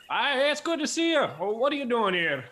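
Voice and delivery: very high, loud squeaky greeting; an overshot falsetto shopkeeper; accidental high-pitched dwarf voice; wrong dwarf voice. Acted. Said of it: "that's not the accent".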